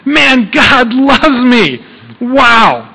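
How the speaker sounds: joyful morning exclamation. Retold or imitated. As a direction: shout it like joy wakes you up. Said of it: "you can't help but screaming".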